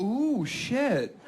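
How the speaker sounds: high overacted startled voice; hammy fake scare. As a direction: play scared badly on purpose. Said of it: "she's toying around with me".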